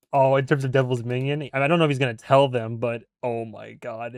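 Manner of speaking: ordinary talk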